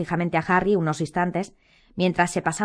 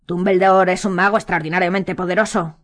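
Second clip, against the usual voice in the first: ordinary delivery, nothing marked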